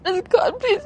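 crying through the words, barely holding together; real crying. Real, not acted. Survivor telling the interviewer about the assault, crying.

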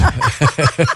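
short real laugh. Real, not acted. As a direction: laugh at your own story. Laughing at own story.

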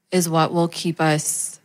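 ordinary talk, nothing marked

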